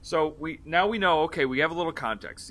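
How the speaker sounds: normal talking voice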